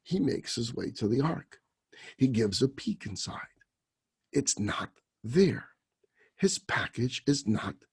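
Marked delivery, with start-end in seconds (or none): 4.3-5.8 hushed whisper. Acted. Simpleton finds package gone.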